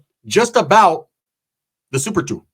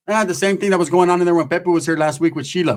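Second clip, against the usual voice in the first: ordinary talk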